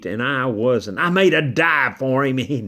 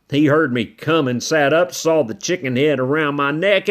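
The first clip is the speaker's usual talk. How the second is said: ordinary delivery, nothing marked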